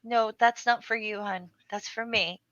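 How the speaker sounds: put-on softly patronizing, as if to a child; condescending. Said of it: "I was so mean".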